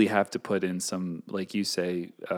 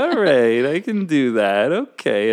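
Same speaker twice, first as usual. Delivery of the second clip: louder, higher mock acceptance; settling down; 4 semitones higher, 7 dB louder than usual. Talking oneself into staying, exaggerated acceptance. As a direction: talk yourself round, playfully. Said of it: "then you settle down and you go".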